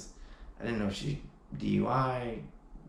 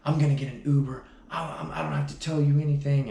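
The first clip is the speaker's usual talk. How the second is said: retold panicked outburst, higher, louder and fast; freaking out; 6 semitones higher, 8 dB louder, faster than usual. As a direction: mimic her frantic snapping back. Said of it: "she just started freaking out".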